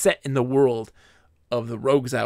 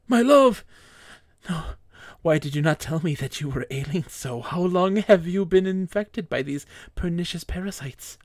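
grieving husband cries out in horror; anguished cry; 4 semitones higher than usual. Acted. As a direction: cry out as he finds her rotting. Husband finds wife's body rotting.

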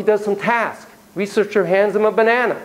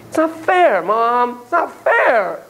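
put-on high kid's complaint to mom; high child whining at mom; whiny kid; 6 semitones higher, much slower than usual. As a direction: whine it at your mother. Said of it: "When a kid says to you".

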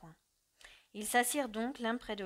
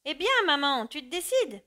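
louder, higher call across the rocks; 5 semitones higher, 8 dB louder than usual. A narrator's reading. Son calls to approaching mother across the rocks, loud. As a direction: call to her from a distance. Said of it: "Jean lui cria".

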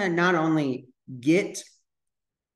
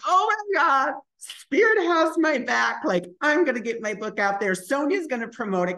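high-pitched overwhelmed gushing; tearful joy; 10 semitones higher than usual. Retold or imitated. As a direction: gush it high, as if welling up. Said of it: "I started to cry".

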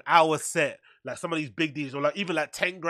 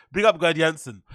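ordinary delivery

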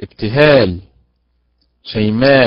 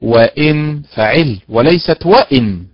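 وإن فاعل وليست وإن said slowly, stress placed carefully; slow stressed model; slower than usual. Shown as a demonstration. Teacher leads the class in repeating the stress, slowly. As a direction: say it slowly so they can repeat. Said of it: "انطقوا معي".

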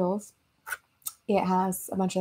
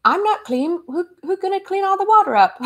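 high, loud comic character voice; pitched-up sketch comedy voice; imitated skit character; 8 semitones higher, 6 dB louder than usual. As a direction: quote a bathroom skit guy, hammed up. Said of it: "where the guy's like".

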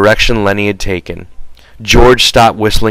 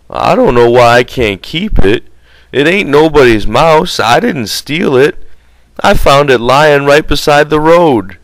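voice breaking; 6 semitones higher than usual. A narrator's reading. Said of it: "His voice broke a little".

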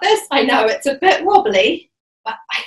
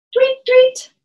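put-on peeping baby chick; 11 semitones higher than usual. Bird song for kids yoga.

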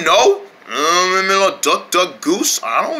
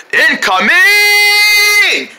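high, very drawn-out cry; warning holler; 10 semitones higher, 4 dB louder, much slower than usual. Acted. Friend warning of something flying in, long high shout. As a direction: hold the last syllable like a siren.

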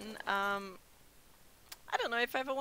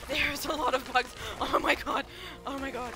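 alarmed, raised voice; panicked; 4 semitones higher, 8 dB louder than usual. Real, not acted. Player spots a lot of bugs, panicked and loud. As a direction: cry out in sudden alarm. Bugs suddenly attack in the game.

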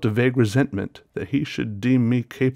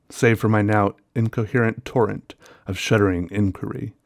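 plain reading voice

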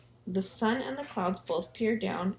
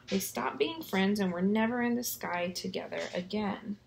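ordinary delivery, nothing marked

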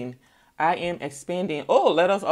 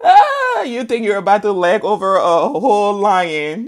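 high, loud amused scoff; mocking laugh; 3 semitones higher, 10 dB louder than usual. Real, not acted. Reader teasing the listener, loud amused scoff. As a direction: laugh at their foolishness.

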